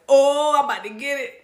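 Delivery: lightly voiced worried child; little me. Inner child seeing dad come inside, imitated little-girl dread. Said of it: "my little me was like".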